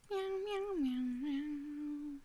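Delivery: high, soft little meows like a kitten; cat meows. Pretend. Streamer meowing to chat while setting up, high and soft. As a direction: meow softly and high, like a playful cat.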